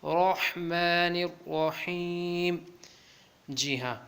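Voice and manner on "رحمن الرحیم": slow recitation, رحمن with a throat-made h; throaty ح. Shown as a demonstration. Teacher modelling the ح for learners, slow throaty recitation of رحمن. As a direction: draw out رحمن الرحیم, h from the throat. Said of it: "ہا کو حلق کے ساتھ ادا کرنا ہے".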